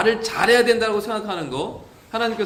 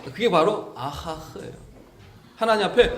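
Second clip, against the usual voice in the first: ordinary talk, nothing marked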